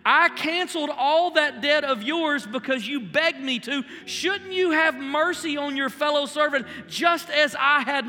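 loud, high, driving sermon voice; full force preaching. Acted. Preacher to congregation about forgiveness, loud and high-pitched. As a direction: preach it out to the back row, full throttle.

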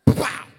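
very loud imitated smack; sudden mimicked bang; explosive impact noise. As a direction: blast out the sound of a collision. Imitates hitting a possum.

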